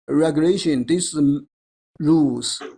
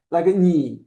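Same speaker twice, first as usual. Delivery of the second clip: ordinary talk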